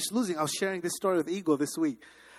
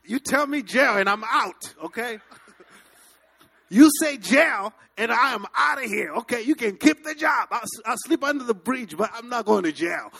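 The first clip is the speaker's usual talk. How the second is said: loud, higher, frantic refusal; panicked outburst; 5 semitones higher, 7 dB louder than usual. Retold or imitated. Telling the boss he is leaving before jail, loud and frantic. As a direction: blurt it out in a hurry to escape. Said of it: "I left like an African".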